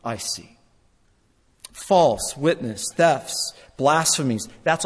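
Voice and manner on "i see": low, soft and knowing; hushed divine voice; quiet voice of the Lord. Acted. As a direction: say it softly, as God who sees all. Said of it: "And the Lord's saying".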